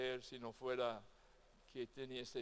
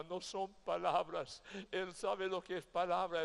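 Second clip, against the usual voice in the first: ordinary talk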